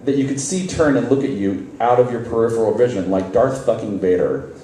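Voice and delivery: plain reading voice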